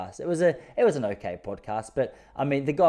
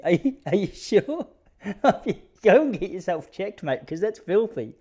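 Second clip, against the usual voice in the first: higher and louder, slow appalled half-laugh; disgusted disbelief; 9 semitones higher, 5 dB louder than usual. Retold or imitated. Recalling reaction to mate Andy's boast, appalled and laughing. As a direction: recoil in mock horror at the filthy detail.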